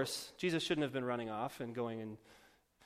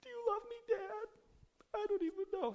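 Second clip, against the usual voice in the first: very high, whiny pretend child voice; whiny kid Jesus; 4 dB louder than usual. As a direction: play a hurt twelve-year-old whining at his parents. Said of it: "come back at their rebuke with his own rebuke".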